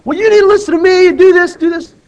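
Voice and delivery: high, loud bossy yell; mock yelling back. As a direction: bark orders like you've lost your temper. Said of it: "he wasn't yelling back at him".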